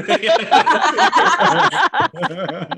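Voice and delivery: loud, high burst of laughter. Real, not acted. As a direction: shriek with laughter. Group joke about going inside.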